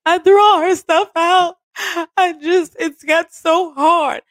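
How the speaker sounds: high, loud, hammed-up wail; mock crying. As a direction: play her sobbing, a bit over the top. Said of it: "Just crying, boo-hooing".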